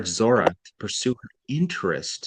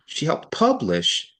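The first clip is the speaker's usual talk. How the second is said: higher and slow on she helped publish; stress on publish; 5 semitones higher, slower than usual. Shown as a demonstration. Teacher repeating the line to show emphasis. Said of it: "now notice I pause there".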